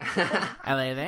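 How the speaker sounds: drawn-out Aussie greeting, rising at the end; Aussie accent. Shown as a demonstration. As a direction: stretch it slowly in an attempted Australian accent. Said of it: "give us your best Aussie accent".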